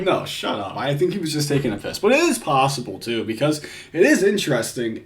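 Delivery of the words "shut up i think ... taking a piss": ordinary talk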